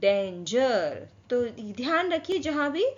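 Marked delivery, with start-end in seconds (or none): 0.0-1.1 very slow "डेंजर" with a j sound inside; soft G word. Shown as a demonstration. Teacher showing how to read the word, slow demonstration. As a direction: stretch "डेंजर" into two long syllables. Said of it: "इसको कैसे पढ़ेंगे".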